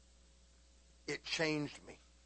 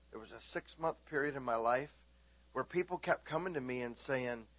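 ordinary talk, nothing marked

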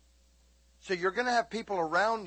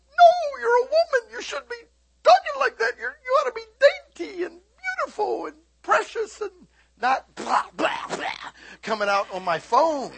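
very high, loud scolding squeal; mock scolding; 8 dB louder than usual. Preacher scolding a cursing customer on the phone, loud high squeal. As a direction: squeal it out like a scandalised mother. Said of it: "and I'm like".

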